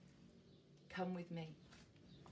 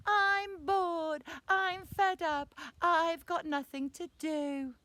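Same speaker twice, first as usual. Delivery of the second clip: loud, high, long-drawn whine; bored little boy whining at mum; whiny voice; 7 semitones higher, 6 dB louder, slower than usual. Acted. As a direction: lead the children in an exaggerated whinge. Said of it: "whiny voice".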